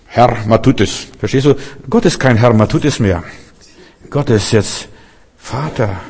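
ordinary delivery

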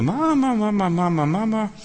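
viel höher und lauter, flehendes Rufen; schreiendes Kind. Retold or imitated. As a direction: ruf wie ein verlassenes Kind nach der Mutter. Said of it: "schreien wir". (hohes, flehendes Kinderrufen nach Mama)

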